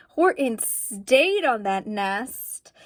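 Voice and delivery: plain reading voice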